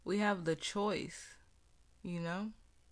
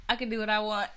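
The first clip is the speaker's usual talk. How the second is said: laughing; 4 semitones higher, 6 dB louder, much faster than usual. Acted. Playful defiance toward God.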